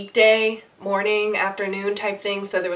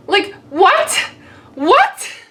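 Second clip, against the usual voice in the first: rising, high and loud what; disbelief; 10 semitones higher, 7 dB louder, much slower than usual. Real, not acted. Looking at the photo with the actor, stunned. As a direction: exclaim in total disbelief.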